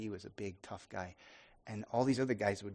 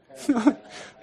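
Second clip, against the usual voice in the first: burst of laughter; 11 semitones higher, 7 dB louder than usual. Real, not acted. Laughing remembering friend's outfit.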